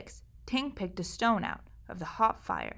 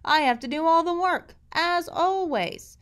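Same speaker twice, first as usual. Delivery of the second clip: higher, louder complaint, slowing at the end; grumbling; 9 semitones higher, 5 dB louder than usual. Acted. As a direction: whine about doing all the work. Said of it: "I grumbled".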